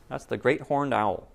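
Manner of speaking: plain reading voice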